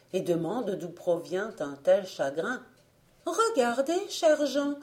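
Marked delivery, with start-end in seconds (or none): 3.3-4.7 jeune fille suppliante, voix bien plus aiguë et forte; elle alerte son fiancé; Alice. A narrator's reading. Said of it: "lui dit Alice". (Alice s'adresse à Jean, voix aiguë et forte)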